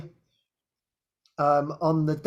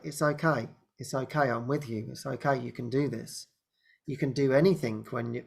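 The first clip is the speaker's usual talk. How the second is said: softer, lower, gentle comforting voice; tender reassurance; 4 semitones lower, 5 dB quieter than usual. Pretend. Jesus reassuring the anxious speaker, soft and tender. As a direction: soothe her like a loving guide.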